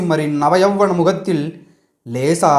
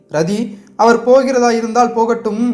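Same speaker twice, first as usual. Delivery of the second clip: a lightly tearful plea, voice raised higher; sobbing voice; 7 semitones higher than usual. Acted. Sivakami to Rati as the prince turns to leave, a mild tearful plea. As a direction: hold back a sob as you say it. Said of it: "விம்மிய குரலில்".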